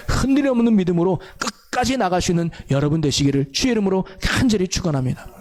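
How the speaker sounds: normal talking voice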